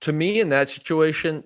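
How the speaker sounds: ordinary talk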